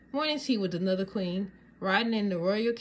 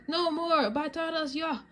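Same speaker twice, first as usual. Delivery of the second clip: shouting; 9 semitones higher than usual. Acted. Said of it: "Dad races out toward the fence".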